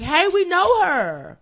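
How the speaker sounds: high, loud, drawn-out acted cheer; slow, stretched excited holler; excited crowd call-out. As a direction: call out like viewers recognising someone. Acts out viewers recognising her.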